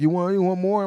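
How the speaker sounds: loud, high mock nagging question. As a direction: pester like curious relatives. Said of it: "people are asking".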